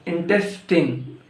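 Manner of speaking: "intestine" ending in short TIN; corrected INTESTINE. Shown as a demonstration. Teacher correcting learners, "intestine" said as in-TES-tin. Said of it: "No, it's not intestine".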